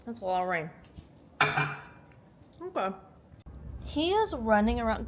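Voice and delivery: usual speaking voice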